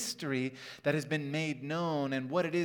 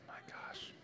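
whispered aside; 17 dB quieter than usual. Real, not acted. Aside after fast pass talk.

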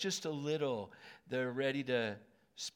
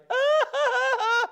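loud high burst of laughter; delighted laugh; 17 dB louder than usual. Retold or imitated. Seeing the Packers winning, a loud high laugh. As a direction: let out a big shrieking laugh.

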